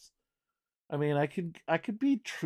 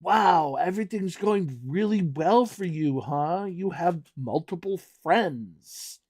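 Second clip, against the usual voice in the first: sarcastic mock; 4 semitones higher, 4 dB louder than usual. Mocking the friend's bragging.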